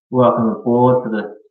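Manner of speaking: ordinary talk, nothing marked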